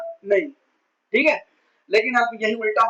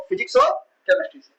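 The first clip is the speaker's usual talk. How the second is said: Physics और chemistry से said much higher, each word ringing out; high, pointed reveal of physics और chemistry; emphatic answer; 13 semitones higher, faster than usual. Shown as a demonstration. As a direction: throw the answer up high to the class. Teacher answers own question emphatically.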